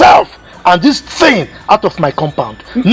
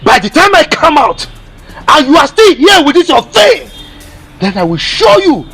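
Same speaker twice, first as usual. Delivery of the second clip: high, raised warning; angry threat; 8 semitones higher than usual. Acted. Warning someone to clear out, angry and high-pitched. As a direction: lay down a heated ultimatum. Threat during an acted quarrel.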